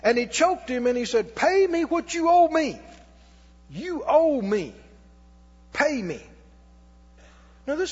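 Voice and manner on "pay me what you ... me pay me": mock debt collector demanding his money; angry demand. Servant choking his fellow servant over the debt, in a harsh demand. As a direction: lean on the debtor, grab him by the collar.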